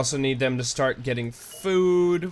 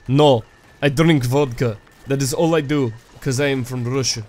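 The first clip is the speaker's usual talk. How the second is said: bad Russian accent; 4 dB louder than usual. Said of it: "everybody apparently wants Russian guy".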